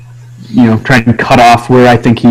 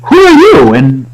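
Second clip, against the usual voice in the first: higher, jumpy challenge; startled question; 7 semitones higher than usual. Acted. Startled ranger questioning the green stranger. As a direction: blurt it out, caught by surprise. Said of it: "jump a little bit and say".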